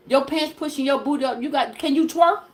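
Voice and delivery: usual speaking voice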